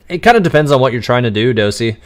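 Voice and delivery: ordinary talk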